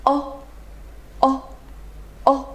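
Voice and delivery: "哦" cut very short; short vowel demo. Shown as a demonstration. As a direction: say a quick, rounded "哦" by itself. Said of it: "非常短促的啊". (短促地示范“哦”)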